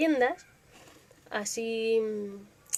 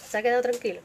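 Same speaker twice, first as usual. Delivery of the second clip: ordinary delivery, nothing marked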